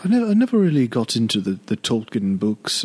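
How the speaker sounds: imitating a snooty British accent; stuck-up British accent. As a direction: put on a posh British voice. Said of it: "a stuck-up kind of British".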